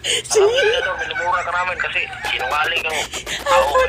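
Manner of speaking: yelling. Real, not acted. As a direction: blow up and shout the insult down the line. Scammer yelling at the caller.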